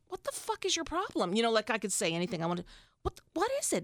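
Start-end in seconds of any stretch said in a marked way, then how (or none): 0.1-1.3 pitched-up mock exasperation; retold frustrated "what the fuck", higher; mock exasperated outburst. Retelling her own outburst. 3.0-3.8 high, pleading mock question; retold baffled plea, much higher; mock exasperated question. Retelling her question.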